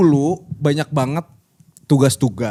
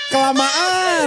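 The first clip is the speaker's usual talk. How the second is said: high, drawn-out word through laughter; slow, high, laughter-filled exclamation; laughing squeal; 11 semitones higher, much slower than usual. Real, not acted. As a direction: squeal it out while cracking up. Cracking up at own joke.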